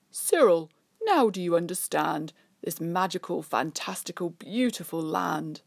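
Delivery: loud, grand king, slowing at the end; a hint of a grand Sun King; kingly voice. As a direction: speak as a kind, proud king. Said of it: "The king says".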